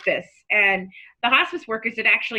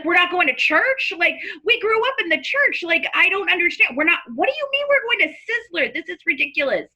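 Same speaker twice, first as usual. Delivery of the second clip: retold angry outburst, much higher pitch; angry disbelief; 8 semitones higher than usual. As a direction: protest the Sizzler funeral. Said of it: "why I was angry".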